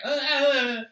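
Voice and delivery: high, loud [name]-style yelps. Retold or imitated. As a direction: yelp "ah" high and loud, like a pop star. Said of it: "He's like".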